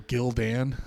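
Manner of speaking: Gildan as two names, Gil and Dan; low, deadpan Gildan, like Gil-Dan; mocked mispronunciation. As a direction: say Gildan with a full DAN at the end.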